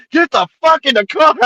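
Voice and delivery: high, loud mock cop yell; retold furious order. Cop ordering two teenagers into his car, retold furious shout. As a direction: imitate a cop yelling at the end of his patience.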